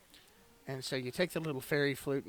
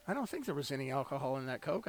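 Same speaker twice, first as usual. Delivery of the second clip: ordinary delivery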